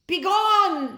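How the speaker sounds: very loud, high scream; loud shrill scream. A narrator's reading. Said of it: "they both screamed".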